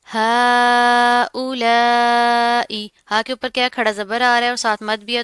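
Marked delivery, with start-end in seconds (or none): 0.0-2.9 very slow, louder recitation with long madd; long drawn vowel. Shown as a demonstration. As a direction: hold the long vowel a long time. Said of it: "तो खींच कर लंबा करके पढ़ेंगे". (मद को खूब खींचकर)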